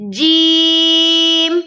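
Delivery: جیم stretched long, slow and sung, ending on a clear م; sung Qur'anic letter recitation; drawn-out letter name. Shown as a demonstration. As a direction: hold جیم long with a ringing م at the end. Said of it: "آخر میں میم کی sound آپ کو محسوس ہونی چاہیے".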